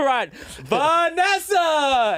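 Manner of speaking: high, drawn-out ring announcer shout; mock big intro yell; announcer's call. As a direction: draw the name out like a fight introduction. Retells announcing the fighter on stream.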